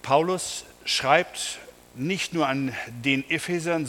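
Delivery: usual speaking voice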